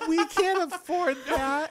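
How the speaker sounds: high, slow mock lament through giggles; envious friends, mocked. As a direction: imitate friends staring in dismay. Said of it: "staring at you like".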